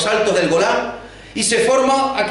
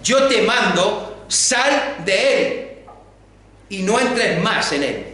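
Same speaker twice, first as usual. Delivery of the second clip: commanding exorcism; 2 semitones higher, slower than usual. Retold or imitated. Jesus orders the demon out, slow solemn command. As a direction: cast the demon out with authority. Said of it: "le dice al demonio".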